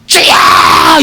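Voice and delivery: "주여!" shouted very high and loud; a little boy screaming to the Lord mid-fall; child's cry while leaping. Retold or imitated. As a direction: yell "주여!" as you jump off a cliff. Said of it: "이러고 뛰어".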